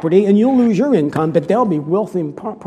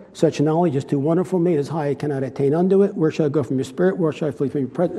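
soft, low, quickening Psalm recital; hushed devotional reading of the psalm; scripture recitation; 5 semitones lower, 4 dB quieter than usual. Acted. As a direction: recite it like a prayer, quieter and quicker as it goes. Reciting Psalm 139 from memory.

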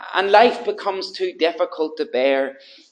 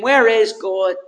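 loud, high anguished questions; a sufferer crying out to God, loud and pleading; desperate crying out; 4 semitones higher, 6 dB louder than usual. Retold or imitated. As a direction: cry out to a silent God. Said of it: "asked yourself the question".

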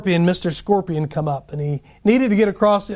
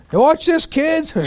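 show-off daredevil; 8 semitones higher, slower than usual. Retold or imitated. Guy showing off with alligator.

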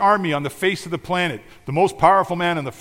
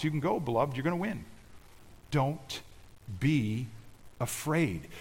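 reassuring; 8 dB quieter than usual. Real, not acted. Reassuring the congregation.

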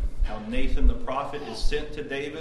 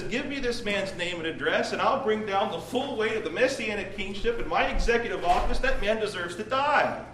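higher, indignant royal sentence; retold outrage; 7 semitones higher than usual. Preacher voicing David condemning the rich man, indignant. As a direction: pronounce doom with mounting indignation. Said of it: "He's angry for the injustice".

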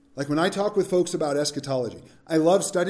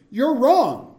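louder and higher, blunt; emphatic; 6 semitones higher, 5 dB louder than usual. Real, not acted. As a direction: snap back firmly. Blunt rebuttal.

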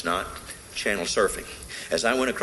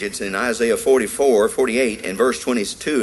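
ordinary talk

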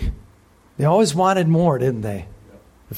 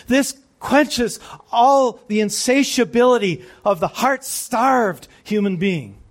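high, loud, rising preacher's fervour; fervent preaching; 7 semitones higher, 3 dB louder than usual. Real, not acted. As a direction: preach it out with swelling joy. Preacher building to a climax.

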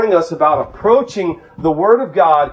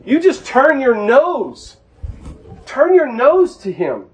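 fervent preaching; 7 semitones higher than usual. Acted. Preacher pleading with the congregation, fervent and raised. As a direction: drive it home to the flock. Preaching mercy at full force.

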